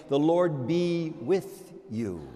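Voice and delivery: slow, measured liturgical wish; slow subjunctive blessing. Shown as a demonstration. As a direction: say it as a hopeful wish. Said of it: "by saying".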